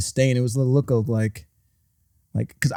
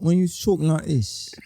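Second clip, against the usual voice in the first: light imitation of a South African accent; 5 semitones higher than usual. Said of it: "Dead shot".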